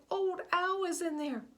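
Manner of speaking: high, loud, urgent cry; frightened shout about Owl; panicked. Acted. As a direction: call out in panic for your friend. Buzz panicking about friend.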